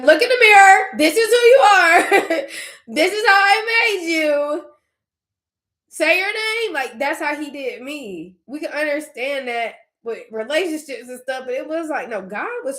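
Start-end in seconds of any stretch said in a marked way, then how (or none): 0.0-6.7 high, loud, tender voice breaking into laughs and tears; much higher and louder, laughing through tears; God's voice, tearful. Retold or imitated. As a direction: lift the voice high as God telling her who she is, half laughing, half crying. Voicing God speaking to her.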